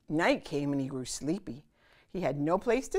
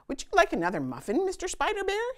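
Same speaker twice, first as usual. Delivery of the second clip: polite. Acted. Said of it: "she asked politely".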